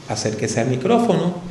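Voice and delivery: soft and close. Shown as a demonstration. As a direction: say it gently, right into the mic. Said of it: "dígalo suavecito".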